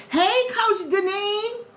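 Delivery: high, long drawn-out hello; calling out greeting. Real, not acted. Streamer greets her coach joining the live, calling out. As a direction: stretch the greeting out high and slow.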